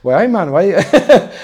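put-on high, slow laughing Geordie accent; mock Geordie lad, drawn out. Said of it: "They would have said it in a Geordie accent".

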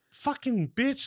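mock outburst of irritation; pissed off. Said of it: "Just fucking pissed me the fuck off".

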